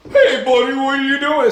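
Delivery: loud, high mock protest; Teddy. Reviewer voicing what Teddy would be like, loud high mock protest. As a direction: call out high and loud, mocking a startled bystander. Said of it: "Teddy's bitch ass would be like".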